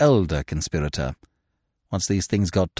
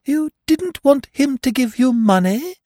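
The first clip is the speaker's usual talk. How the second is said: very high, incredulous repeat; wondering; 14 semitones higher, 3 dB louder than usual. A narrator's reading. Freddy repeats Eve's words back, wondering. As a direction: echo it in utter wonder.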